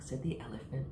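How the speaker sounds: ordinary delivery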